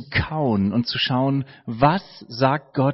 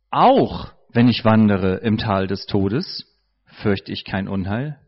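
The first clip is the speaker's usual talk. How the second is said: "auch" opens the line low and leaning; stress on auch; 6 semitones lower than usual. Shown as a demonstration. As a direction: lean into "auch", then let the verse fall. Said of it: "mit verschiedenen Betonungen verschieden lesen".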